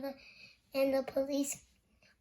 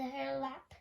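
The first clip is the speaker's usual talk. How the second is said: ordinary delivery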